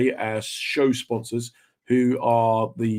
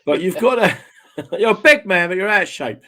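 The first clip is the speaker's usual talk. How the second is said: higher and louder, laughing through it; laughing quote; 6 semitones higher, 3 dB louder than usual. Retold or imitated. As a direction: repeat the line with a laugh. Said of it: "in the words of, Michael Caine".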